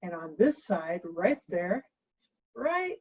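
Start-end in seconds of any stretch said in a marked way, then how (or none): none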